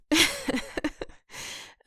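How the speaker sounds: big released laugh, falling away; loud laugh. Real, not acted. Lover laughing at own emotions, loud laugh. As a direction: let out a loud tired laugh.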